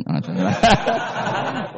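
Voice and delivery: high burst of laughter; amused high laugh. Real, not acted. Laughs at Umar's consistency.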